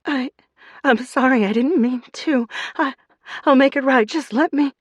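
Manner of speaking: high, loud stammering apology; terrified stammer. A narrator's reading.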